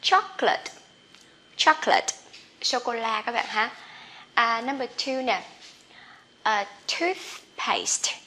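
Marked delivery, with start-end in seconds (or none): 0.0-2.1 chocolate said higher, as two syllables choc-late; model chocolate. Shown as a demonstration. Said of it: "không đọc là chocolate nha". 6.4-8.1 toothpaste said very slowly, tooth and paste pulled apart; slow model. Shown as a demonstration. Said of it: "Từ này hơi khó đọc".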